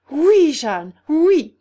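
high, loud reassurance; fervent cry. A narrator's reading. Guillaume and Alain reassure Jeanne, crying out together. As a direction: call out emphatically to reassure her. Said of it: "s'écrièrent à la fois".

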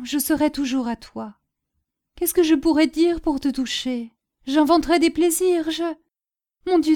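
high-pitched, pressing plea; impassioned begging. A narrator's reading. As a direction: plead without letup, offering everything to save your lover. Woman begs for lover's life. (supplication passionnée, d'une voix aiguë)

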